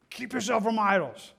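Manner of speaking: mildly angry command, pushed out sharply; mock angry. Angry warning misheard by listeners, mildly stern. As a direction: give it as an angry order. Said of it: "almost an angry statement".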